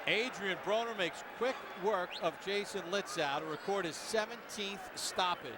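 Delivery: normal talking voice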